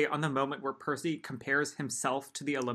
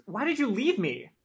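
hurt pleading; 6 semitones higher than usual. Acted. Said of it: "Annabeth's voice cracked".

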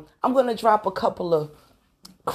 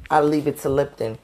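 ordinary talk, nothing marked

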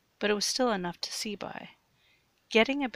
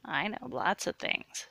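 ordinary delivery, nothing marked

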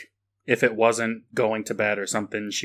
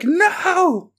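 much higher, louder panicked cry; hammed-up child terror; mock scared girl; 5 dB louder than usual. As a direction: cry out like a little girl faking fear. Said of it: "And she'd be like".

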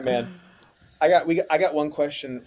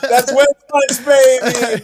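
excited shout; 11 semitones higher, 15 dB louder, slower than usual. Real, not acted.

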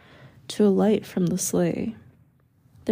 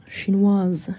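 muttered French word, kept low; French, lower tones. A narrator's reading. Villagers whispering among themselves, low French aside. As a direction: say it in French under the breath. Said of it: "Then, in lower tones".

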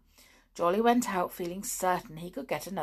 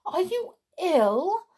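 worried; 12 semitones higher, 6 dB louder, slower than usual. Acted. Tiptoe worried about the clown.

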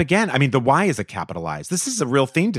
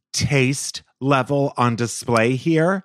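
slow, emphatic reading landing hard on "taste"; all-caps emphasis; 2 semitones higher, much slower than usual. Shown as a demonstration. Host reads a listener review's all caps, slow and emphatic. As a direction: stretch out "taste" slowly, every word given weight. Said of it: "all caps".

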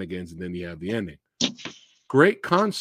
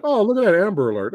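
higher, louder, quick blurt; quick raised-voice exclamation; acted casual remark; 8 semitones higher, 6 dB louder, much faster than usual. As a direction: toss it out fast and high, as a quoted reaction. Acting characters spotting the sign.

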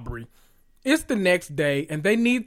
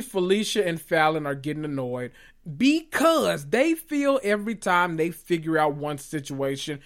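usual speaking voice

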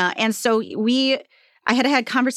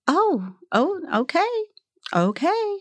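high, hesitant "okay"; polite disappointment; 9 semitones higher than usual. Retold or imitated. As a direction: let the writer down gently. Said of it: "she was just like".

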